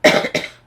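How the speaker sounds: sharp real cough. Real, not acted. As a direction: cough out loud. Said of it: "Excuse me".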